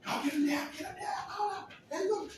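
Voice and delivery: high-pitched hollering; hammed-up panic in short bursts; mock frantic orders. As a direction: call out urgent commands way up high. Acted frantic order to restrain someone.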